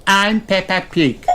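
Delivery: very high little piglet voice; perky high girl voice with a snort; Peppa Pig. A narrator's reading. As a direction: piglet introducing herself. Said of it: "snorts Peppa".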